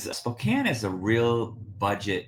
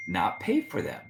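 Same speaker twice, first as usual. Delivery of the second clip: ordinary delivery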